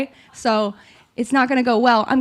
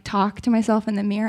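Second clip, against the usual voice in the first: normal talking voice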